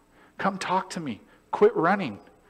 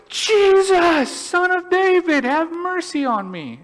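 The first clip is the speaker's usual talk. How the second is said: much higher, much louder desperate cry; 7 dB louder, slower than usual. Retold or imitated. Blind man crying louder to Jesus, desperate. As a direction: put everything into the cry. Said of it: "And he cries louder".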